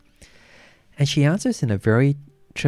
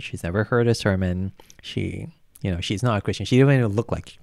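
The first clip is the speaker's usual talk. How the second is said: usual speaking voice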